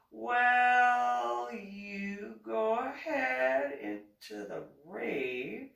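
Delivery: high, very slow, stretched-out words; drawn-out mock reply. Imitating police officer sending her into rave, drawn-out. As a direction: drag each word out in a put-on voice.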